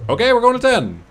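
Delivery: high, loud and thrilled; excited. Real, not acted. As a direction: announce it with glee. Excited price jump.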